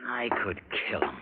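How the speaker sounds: low whispered threat, voice dropped; whispered fury. Acted. Husband to wife about the uncle, whispered rage. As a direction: seethe it under your breath.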